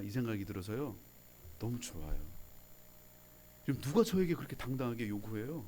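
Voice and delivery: soft, low, tender reflection. Acted. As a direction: quietly share what moves you.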